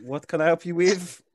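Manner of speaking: lightly voiced old lady; a hint of a polite old lady. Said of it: "she goes".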